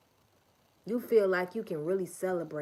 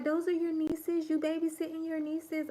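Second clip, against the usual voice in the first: high, excited store clerk voice; imitating the store lady; 10 semitones higher than usual. Store lady gushing at a young mom. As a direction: squeal it like a surprised stranger. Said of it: "She talking about".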